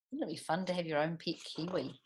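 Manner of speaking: plain reading voice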